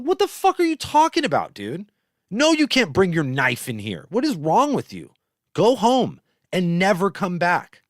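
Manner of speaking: loud, high-pitched indignant rant; acted outraged dressing-down; exasperated scolding. Acted scolding of Joe Cutter.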